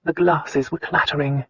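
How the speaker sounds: usual speaking voice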